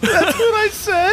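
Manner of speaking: high, slow, crying with laughter; laughing hard. Real, not acted. Joking with a friend about sleep talk, laughing hard. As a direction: say it through helpless laughter.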